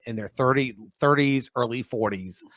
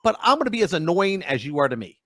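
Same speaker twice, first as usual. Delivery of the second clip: mock sarcastic deal; 7 semitones higher than usual. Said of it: "lay into them and go".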